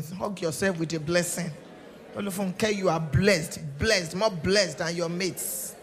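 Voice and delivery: forceful blessing prayer; preaching prayer. Real, not acted. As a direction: declare blessings with fervour.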